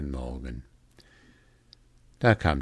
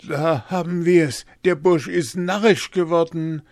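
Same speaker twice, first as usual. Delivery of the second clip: high, loud dismissal; exasperated alarm; 11 semitones higher, 7 dB louder than usual. A narrator's reading. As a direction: declare loudly that he has lost his mind. Sexton dismisses Hans as crazy.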